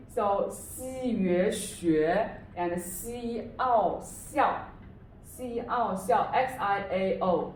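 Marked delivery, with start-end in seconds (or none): 0.1-6.3 西约学 and 西奥 stretched out slowly, syllable by syllable; slow sound breakdown. Shown as a demonstration. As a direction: spell 西奥校 out in slow parts. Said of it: "the X is pronounced as".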